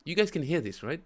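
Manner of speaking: eager question to the viewers; amused. Real, not acted. Asking chat, amused.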